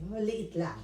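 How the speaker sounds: ordinary talk, nothing marked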